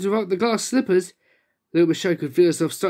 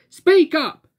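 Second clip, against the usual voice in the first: much higher, loud bark; loud order; 9 semitones higher, 6 dB louder than usual. Acted. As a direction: boom it across the shop. Boomed Little Miss Spotty.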